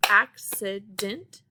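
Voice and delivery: ACCIDENT slowly broken into three separate syllables. Shown as a demonstration. Teacher counting syllables for the class, "accident" said slowly in three parts. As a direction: sound out "accident" slowly, one syllable at a time. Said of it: "has three syllables".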